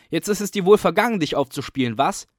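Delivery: loud, high, fast taunt; hoarse yell. A narrator's reading. Coco taunting the beaten caretaker, hoarse yell. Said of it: "mit heiserer Stimme brüllen".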